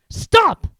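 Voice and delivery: loud high command. Acted. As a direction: order him to stop. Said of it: "he commanded".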